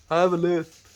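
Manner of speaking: I have a lisp with the S lisped; mock lisp. Shown as a demonstration. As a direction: put a playful lisp on lisp. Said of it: "like putting an S in lisp".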